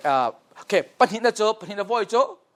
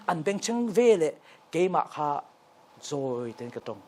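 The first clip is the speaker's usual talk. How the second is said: usual speaking voice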